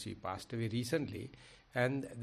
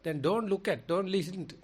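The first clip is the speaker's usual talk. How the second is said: raised, louder and higher, insisting; emphatic retold command; 6 semitones higher, 5 dB louder than usual. Sariputta's advice retold to the meditator, loud and insistent. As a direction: press each don't harder as if warning a pupil. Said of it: "Okay, he says".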